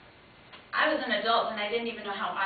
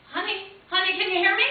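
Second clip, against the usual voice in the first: high, loud worried mom on the phone; calling out to her daughter on a bad line; 8 semitones higher, 10 dB louder than usual.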